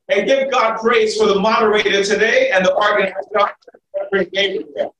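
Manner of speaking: high, ringing call to praise; rally the congregation to their feet; preacher's greeting. Real, not acted.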